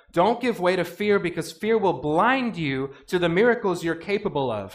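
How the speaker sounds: usual speaking voice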